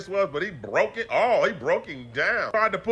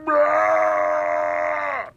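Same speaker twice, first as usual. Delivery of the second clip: long high screaming roar; hammed-up wrestler freak-out scream; mock enraged roar; 10 semitones higher, 3 dB louder than usual. Kane freaking out back in the ring, long mock roar. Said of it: "He really tweaking. He like".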